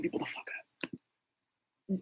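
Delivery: a slow unvoiced whisper; hushed and slow, as if someone might hear. Real, not acted. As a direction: let the secret out under the breath. Revealing secret about being set up.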